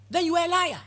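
high, loud rebuke on "liar"; sharp preaching rebuke; loud accusation. Real, not acted. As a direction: call out the liar from the pulpit. Preacher confronting the hypocrite.

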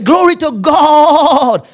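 high, loud praise cry with a long final word; exaggerated slow proclamation; jubilant shout. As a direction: stretch it out in mock triumph. Acted triumphant self-declaration.

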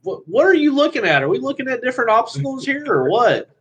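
high, incredulous scoffing; exasperated mock rant. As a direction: scoff at them in disbelief. Mocking exasperated novice drivers.

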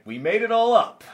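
high, loud mock reveal; TV host impression. Imitating the host's end-of-show reveal, loud and theatrical. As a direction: proclaim the twist like a show host. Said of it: "he was like".

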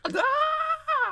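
high-pitched imitated scream; mock scream. Retelling how she screamed in bed, mock high scream. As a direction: shriek it way up high. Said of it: "she was screaming something".

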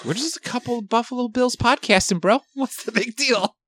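lightly creepy high Buffalo Bill impression. Podcaster mocks his own old voice with a light creepy killer impression. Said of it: "That's who I fucking sound like".

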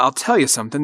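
normal talking voice

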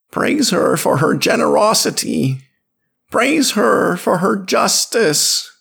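high, loud fervent chant; ritual praise. A narrator's reading. Tribe kneeling before the woman of the water, loud high chant.

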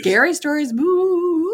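high, loud hammed-up ghost voice; spooky voice. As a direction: boo them like a playful ghost. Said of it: "That's my spooky voice".

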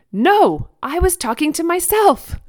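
high, loud mock tantrum; imitated toddler outburst. A narrator's reading. Toddler rebuffing mom's sympathy, high mock outburst. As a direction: protest like an exasperated two-year-old. Toddler protest voiced in story.

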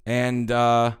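ordinary delivery

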